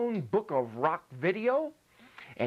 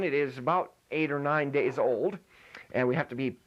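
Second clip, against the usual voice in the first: ordinary delivery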